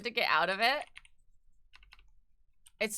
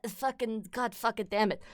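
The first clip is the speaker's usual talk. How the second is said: ordinary talk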